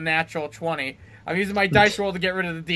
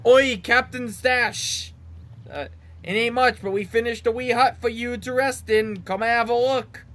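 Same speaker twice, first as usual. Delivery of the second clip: higher, put-on British-type accent; maybe-British accent; 6 semitones higher than usual. Game character inviting Captain Stash to the hut, vague British accent. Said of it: "it might be British".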